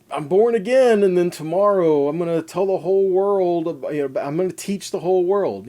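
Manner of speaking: breathless mock enthusiasm of a fresh convert; overeager new convert. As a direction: play the brand-new believer who wants to teach everyone now.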